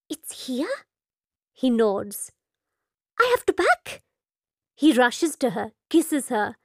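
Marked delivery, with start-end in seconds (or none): 0.1-0.8 soft, high startled question; surprised. Acted. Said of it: "She nearly drops her comb". 3.2-4.0 high, rushed flustered voice; excited. Acted. Meeta flustered, about to flee.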